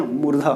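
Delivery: careful teacher model of मूर्धा with a retroflex र्ध; correct pronunciation demo. Shown as a demonstration. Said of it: "मुर्दा नहीं ना".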